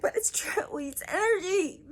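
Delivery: very high, half-whispered protest; mock earnest plea. Imitating herself insisting to the scientific group, very high and breathless. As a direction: squeak out her defence with wide-eyed insistence. Imitating her own pleading reply.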